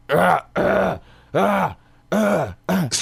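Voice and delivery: high rising ah sounds; voice warm-up. Shown as a demonstration. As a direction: clear the morning voice with ahs. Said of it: "I'll be like".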